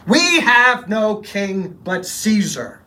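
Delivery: slow, high, loud declaration; bold shout. Acted. High priest boldly shouting down the governor, slow and loud. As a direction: shout it across the whole grounds. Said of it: "boldly shouted".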